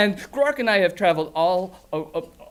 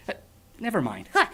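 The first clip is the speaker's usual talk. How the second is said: ordinary delivery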